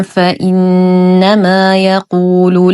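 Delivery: slow, drawn-out tajweed recitation; Quran recitation. Shown as a demonstration. Teacher recites the whole verse for learners, slow measured recitation. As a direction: chant the verse with long held vowels and hums.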